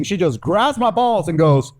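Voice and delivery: ordinary talk, nothing marked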